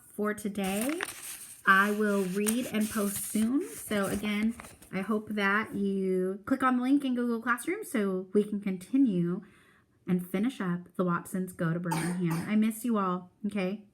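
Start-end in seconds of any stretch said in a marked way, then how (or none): none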